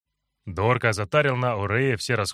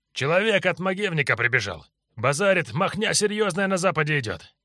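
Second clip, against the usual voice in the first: higher street-thug voice, excited; 7 semitones higher than usual. Acted. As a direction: gabble the news like a street thug. Henchman reports in thug voice.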